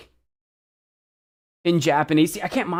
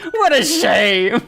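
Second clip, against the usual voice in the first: loud, higher, theatrical lament; big, overdone dismay; hammed-up exclamation; 6 semitones higher, 7 dB louder, slower than usual. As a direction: proclaim it with mock drama. Hamming up favorite phrase.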